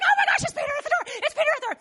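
high, rapid, giddy squeal; overjoyed. Acted. Rhoda realises Peter is at the door, overjoyed. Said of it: "she gets so overjoyed".

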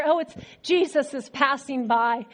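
ordinary delivery

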